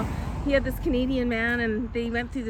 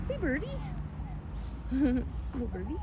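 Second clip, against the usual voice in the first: cooing at a bird; 3 semitones higher, 7 dB quieter than usual. Real, not acted. Talking to a bird.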